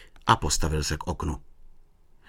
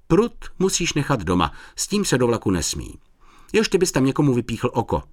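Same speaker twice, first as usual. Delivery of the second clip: higher, firm motherly order; commanding mother; 6 semitones higher than usual. A narrator's reading. Mum forbids Honzík the stick, firm. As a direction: lay down the rule to be obeyed. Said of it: "řekla maminka takovým hlasem, který Honzík vždycky hned poslechl".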